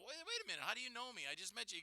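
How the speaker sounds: high, baffled double take; puzzled surprise. Retold or imitated. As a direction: stop short, suddenly suspicious. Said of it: "and I stop, and I go".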